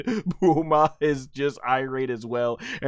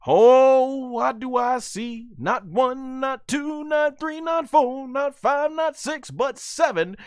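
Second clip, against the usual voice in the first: big high-pitched suspense count; dramatic reveal; 12 semitones higher, 5 dB louder, slower than usual. Acted. Narrator counting the approaching enemies, dramatic reveal. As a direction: build up the reveal, number by number.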